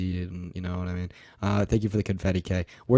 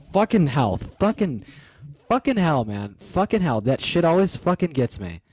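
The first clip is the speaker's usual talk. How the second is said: startled swearing; 4 semitones higher, 4 dB louder than usual. Real, not acted. Scared by loud gift sound.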